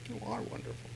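You are wonderful breathed as a whisper; whispered praise. Shown as a demonstration. Preacher acting doting mom, whispered praise. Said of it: "rewarding to Mom".

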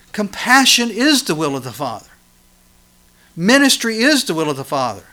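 loud, high, driving preacher's voice; full-force preaching. Acted. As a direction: preach it out to the congregation.

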